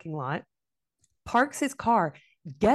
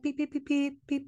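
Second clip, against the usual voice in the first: high staccato beeps like a horn; mock honking in short bursts; imitated car horn beeps; 7 semitones higher than usual. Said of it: "It was like beep the whole time".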